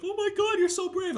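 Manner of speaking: high-pitched mock gushing; put-on high, fawning voice; gushing family member. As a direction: imitate an admiring relative, voice way up high. Imitating family gushing over him.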